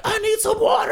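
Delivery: high, loud mock shout; hammed-up plea from the next cell; imitated cell neighbour. Imitating the dude next door yelling for water, high and loud.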